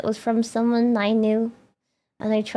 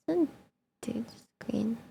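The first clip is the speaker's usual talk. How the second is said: breathy whispered aside to herself; 3 semitones lower, 11 dB quieter, much slower than usual. Real, not acted. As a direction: murmur it under your breath, barely voiced. Reading settings quietly to herself.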